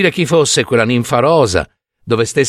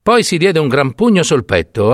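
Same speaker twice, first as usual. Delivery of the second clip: plain reading voice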